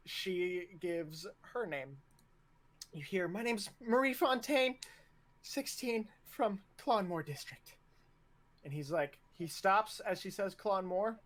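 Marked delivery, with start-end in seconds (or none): 3.3-7.8 higher-pitched formal roll-call answer; cadet reporting. Acted. Cadet giving name and district to officer, higher voice. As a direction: pitch it up and report to your superior.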